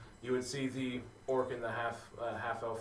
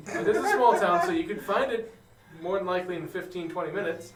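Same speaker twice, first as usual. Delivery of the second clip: laughing; 6 semitones higher, 10 dB louder than usual. Real, not acted. GM laughing while explaining.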